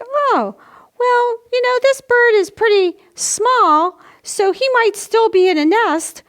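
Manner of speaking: high, light musing voice, thinking it over; musing to herself. Retold or imitated. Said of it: "I was like".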